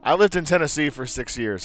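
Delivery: usual speaking voice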